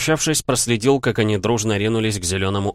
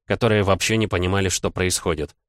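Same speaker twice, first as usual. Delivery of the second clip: ordinary delivery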